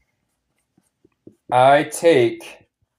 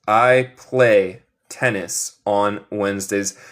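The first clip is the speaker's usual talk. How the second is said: slow model sentence; 3 semitones lower, slower than usual. Shown as a demonstration. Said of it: "Yo juego tenis en miércoles".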